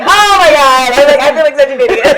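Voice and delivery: high, loud laughing groan at herself; realizing it was only the subway, laughing embarrassment; embarrassed realization. Retold or imitated. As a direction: cringe at your own mistake.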